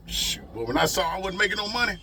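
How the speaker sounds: usual speaking voice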